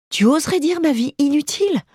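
high, indignant cry of a lady wronged; outraged mistress. Acted. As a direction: fling the question back, offended. Kiki outraged at the dog.

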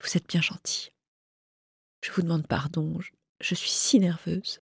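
soft, breath-light apology; hushed, hesitant confession; murmur. Acted. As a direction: murmur it after crying, very softly. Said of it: "murmura-t-elle".

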